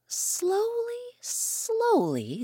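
very slow, stretched storytelling; slow drawn-out. A narrator's reading. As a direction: draw out each slowly. Narrator stretches the crossing.

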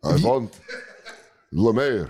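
hypnotize him with a lazy vampire drawl. Pretend. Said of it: "I'm gonna start doing it in the vampire voice".

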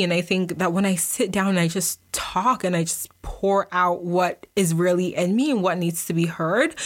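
normal talking voice

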